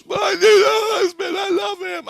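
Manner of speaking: shrieked, wailing imitation of her; mock hysterical scream. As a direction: scream it out very high and loud, hammed-up. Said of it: "So she just screamed".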